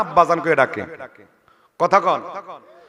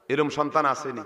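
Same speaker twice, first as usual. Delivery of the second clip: ordinary talk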